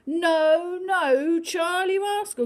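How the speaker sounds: loud, high "no, no" scold; scolding mum. Acted. Mum telling off the cat, loud high scold. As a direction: tell the naughty cat off, loudly.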